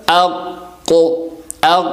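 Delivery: اق with a short hold, then a bouncing ق release; qaf with qalqala. Shown as a demonstration. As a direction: hold the ق in اق, then let it echo. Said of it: "ہم وقف کریں گے قاف کے اوپر". (اق میں ق پر رک کر قلقلہ)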